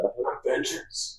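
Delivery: soft, slow, voiceless vigilante rasp; Batman. Retold or imitated. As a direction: rasp it slowly under your breath as a mock superhero. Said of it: "that the ridiculous voice".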